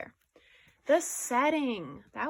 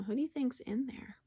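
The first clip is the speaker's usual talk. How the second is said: usual speaking voice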